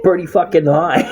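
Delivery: amused, incredulous; laughing disbelief. Real, not acted. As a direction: chuckle at how absurd the bill was. Laughing about high bill.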